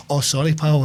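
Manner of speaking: a light Scottish lilt on pal; imitating a wee Scottish lad apologising; mock Scottish sorry, pal; Scottish impression. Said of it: "he's got this beautiful voice".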